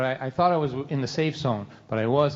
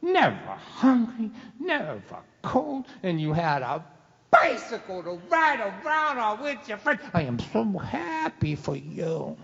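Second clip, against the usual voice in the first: high, slow sarcastic list; mocking dad; 8 semitones higher than usual. Dad finishing off his son, slow and sarcastic. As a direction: draw it out with mock joy.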